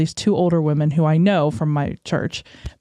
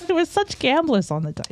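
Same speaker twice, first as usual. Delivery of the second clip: high, softer mock Jersey voice; New Jersey accent; 8 semitones higher, 4 dB quieter than usual. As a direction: imitate a Jersey woman, high and light. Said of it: "I always give them a New Jersey accent".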